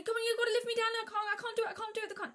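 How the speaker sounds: put-on high, fast, desperate child's wail; upset child pleading. Girl stuck in tree begging parents below, high tearful wail. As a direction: beg tearfully to be lifted down. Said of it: "really upset".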